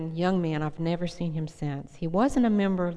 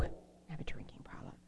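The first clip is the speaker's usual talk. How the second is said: whispered confession; 18 dB quieter than usual. Retold or imitated. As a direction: say it under your breath like a secret. Friend confessing her drinking problem.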